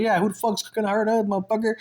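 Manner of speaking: high-pitched, confrontational comeback; retold angry challenge. Speaker walking up to back his brother, angry challenge. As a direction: act out getting mad and stepping up. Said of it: "'Cause now I got mad".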